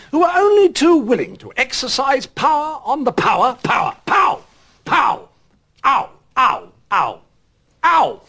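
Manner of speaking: sharp ow yelps, rising; cries of pain. Acted. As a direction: yelp out in sudden pain. In pain mid-broadcast.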